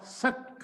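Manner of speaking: सक whispered as a short breathy syllable. Shown as a demonstration. Teacher showing the first syllable of success, whispered. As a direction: breathe out सक without voice. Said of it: "देखो जरा इसको ध्यान से".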